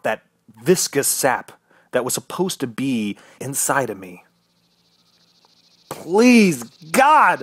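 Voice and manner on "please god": loud, high-pitched plea to God; desperate yell. Acted. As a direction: cry it out, begging. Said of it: "I yelled".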